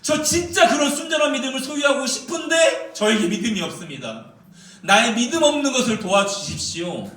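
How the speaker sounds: a father shouting his confession; desperate plea. Shown as a demonstration. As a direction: plead with the Lord, voice straining. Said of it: "이런 표현인 것 같아요".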